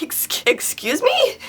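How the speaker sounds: hurt and startled, falter over it; stunned disbelief. Retold or imitated. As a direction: caught off guard by a stranger's insult. Shocked reply to insult.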